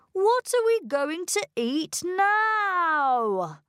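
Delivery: high, slow, drawn-out moan; hungry whine.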